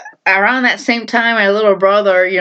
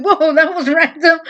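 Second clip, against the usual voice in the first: laughing surprise; 9 semitones higher than usual. Retold or imitated. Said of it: "looked at each other like".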